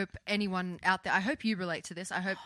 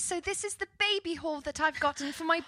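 higher sweet vlogger voice; mom vlogger voice; 6 semitones higher than usual. Retold or imitated. Mocking the YouTuber's baby haul video, higher and sweet. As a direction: coo like a mommy vlogger. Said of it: "now her videos are like".

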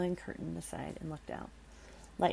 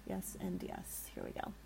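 hushed; 11 semitones lower, 7 dB quieter than usual. Real, not acted. Bracing herself to read on.

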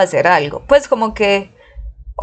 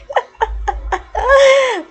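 high, bright laugh breaking out; burst of laughter. Real, not acted. Telling about her first training day, real high laugh.

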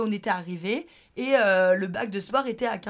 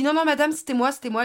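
insistent protest; 6 semitones higher, 6 dB louder, faster than usual. Retold or imitated. Insisting to the woman.

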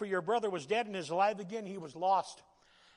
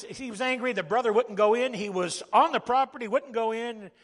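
preaching at full force; 4 semitones higher, 7 dB louder than usual. Acted.

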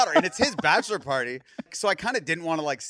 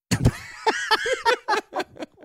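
big laugh; 5 dB louder than usual. Real, not acted. Laughing at Lucifer name.

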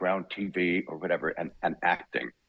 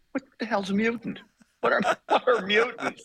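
incredulous. Retold or imitated. Incredulous retold audition question.

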